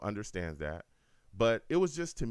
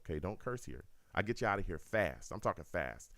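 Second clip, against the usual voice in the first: normal talking voice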